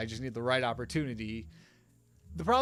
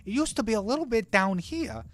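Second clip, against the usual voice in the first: much higher, louder, bouncy character voice; higher, bouncier voice; 10 semitones higher, 3 dB louder than usual. Shown as a demonstration. Showing viewers fifty-one's new voice, higher and bouncy. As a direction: pitch it way up with bounce. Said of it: "up here with a little bit more high pitch".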